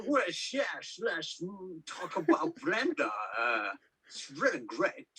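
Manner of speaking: slushy Danish Sean Connery take, drawn out; hammed-up Connery-ish accent, swooping up and down; muddled put-on accent. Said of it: "I don't know what accent I'm doing".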